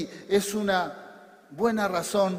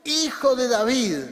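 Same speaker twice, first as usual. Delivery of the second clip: high, loud call to Jesus; crying out; 6 semitones higher, 7 dB louder than usual. Acted. Bartimeo hearing Jesus was near, crying out. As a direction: project it loudly, pitched up. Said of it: "comenzó a gritar".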